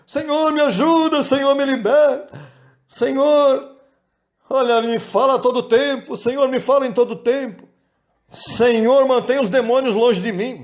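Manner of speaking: high pleading petition, hammed up; mock begging prayer. Preacher mocks believers begging God in prayer, high whiny plea. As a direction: parody a whiny prayer that only asks for things. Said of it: "mas são cultos de pedição".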